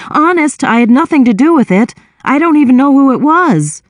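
flustered protest. A narrator's reading. Brother insisting to his sister he is innocent, flustered and fast. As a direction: blurt the excuse out quickly.

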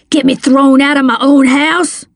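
understated angry growl; growled outrage. A narrator's reading. Man grabbing Miss Rose over his eviction, growling. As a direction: throw it back at her, indignant. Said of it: "he growled".

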